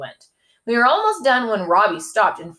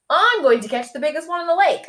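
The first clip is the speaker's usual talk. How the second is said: boastful, a bit higher; bragging; 9 semitones higher, faster than usual. A narrator's reading. Freddie to Robbie and dad, mild bragging. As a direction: brag about the big catch. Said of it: "I bragged".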